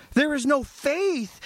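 ordinary talk, nothing marked

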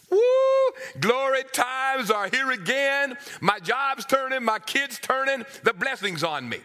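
playfully hopeful, "glory times"; excited prophet. Acted. Prophet's hopeful inner thoughts.

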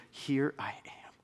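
slow whisper; hushed surrender. Acted. Listener answering the call of Jesus, whispered.

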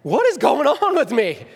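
high, loud, half-laughing alarm; mock terror through a laugh; laughing at his own fright; laughing panic. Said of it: "and I'm like".

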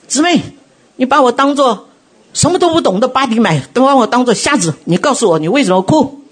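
a mock-earnest church deacon pressing her; imitating the deacon. Deacon pressing the sister on why she cries, voiced high. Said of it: "那个执事来问我说".

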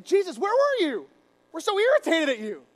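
loud, high irritated demand. Acted. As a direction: snap at him in loud, acted annoyance. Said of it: "they get irritated".

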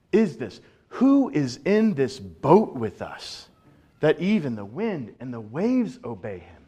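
stunned men in a boat asking who this is; awestruck disciples. Acted. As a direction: disciples in the boat, struck with awe at the calm. Disciples awed by the stilled storm.

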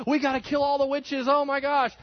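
high, loud hammed-up frenzy; hysterical mob. Caught-up colonists crying out against witches, in mock hysteria. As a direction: whip up a mock panic. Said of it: "caught up in emotionalism".

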